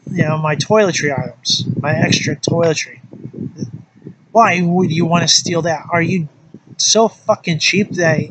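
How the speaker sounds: ordinary delivery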